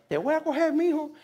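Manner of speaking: a touch of a scolding mom, high; mom's threat. Retold or imitated. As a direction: playfully voice his mom warning him. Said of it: "She's watching online right now".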